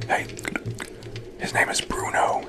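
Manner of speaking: ordinary talk, nothing marked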